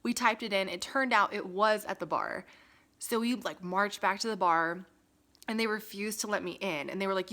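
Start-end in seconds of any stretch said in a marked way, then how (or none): none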